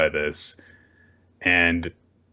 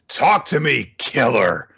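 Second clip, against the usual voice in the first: raised-voice plea, higher at the start; mock-dramatic shout at a lost partner; acted desperate demand; 4 semitones higher, 4 dB louder, slower than usual. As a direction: call out as if demanding an answer. Kid demanding answers from lost partner.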